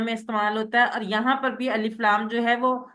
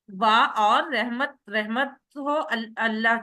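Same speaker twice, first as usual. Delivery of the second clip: slow dictation; slower than usual. Shown as a demonstration. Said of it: "teacher dictating slowly".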